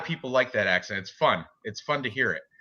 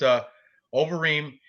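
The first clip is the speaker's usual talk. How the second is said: ordinary delivery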